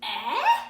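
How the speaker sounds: loud questioning Eh; the short e sound Eh, called out loud. Shown as a demonstration. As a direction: say Eh as an asking shout. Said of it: "little E's short name would be".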